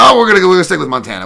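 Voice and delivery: loud, fast rush of words; excited outburst. Real, not acted.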